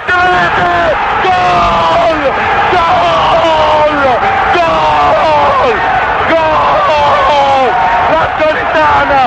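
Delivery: repeated shouted goal cries; breathless italian goal commentary. Real, not acted. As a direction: yell each goal at full voice. Archive radio goal call.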